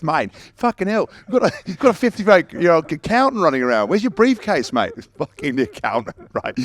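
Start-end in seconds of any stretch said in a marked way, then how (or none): 0.0-4.9 high, loud mocking Aussie coach voice; mock the teammate like a brash coach; coach's mocking jab. Mocking a teammate as an old accountant, high and loud. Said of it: "He goes".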